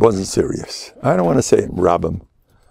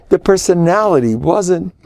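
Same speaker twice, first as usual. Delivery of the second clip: usual speaking voice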